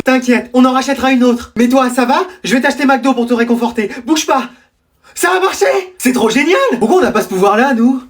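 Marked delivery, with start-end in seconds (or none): 5.2-6.6 high, thrilled cheer; acted glee, pitch shooting up; gleeful celebration. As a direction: burst out delighted. Celebrating that the trick worked.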